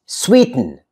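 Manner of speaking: sweeten as sweet-n. Shown as a demonstration. Teacher modelling natural speech, sweeten with bare N ending. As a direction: drop the vowel in sweeten, T straight into N. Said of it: "I say sweeten".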